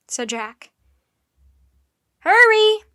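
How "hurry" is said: high, loud urging call; loud call to hurry up. A narrator's reading. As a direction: hurry them along from the bus. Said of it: "Nancy called to them".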